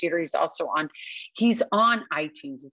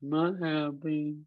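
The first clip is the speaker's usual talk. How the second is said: gloomy Eeyore twin; much slower than usual. Retold or imitated. Imitating the gloomy brother who walks in, slow and mopey. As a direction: drag every word like it hurts. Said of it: "the brother comes in, and he's like".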